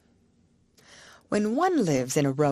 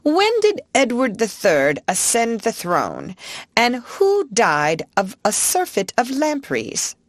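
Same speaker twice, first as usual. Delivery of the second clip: quizzing uncle; 7 semitones higher than usual. Retold or imitated. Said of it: "my uncle is always asking".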